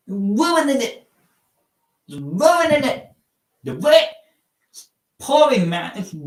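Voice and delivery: high, far-off mock British "Ruining it" with the t dropped; "Ruining it" sung up high like a Brit; mock British accent. Shown as a demonstration. Streamer mocking British slang, high mock British accent. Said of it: "What a British slang".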